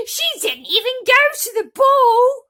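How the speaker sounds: very high, loud, slow wailing cry; crying protest. Acted. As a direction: wail in disbelief. Gertrude wailing.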